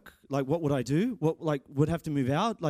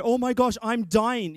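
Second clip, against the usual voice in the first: higher, louder hammed-up alarm at a search result; mock panic; 6 semitones higher, 4 dB louder than usual. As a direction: play the overreacting googler. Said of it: "What does Google say?".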